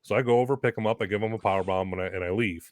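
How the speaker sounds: ordinary delivery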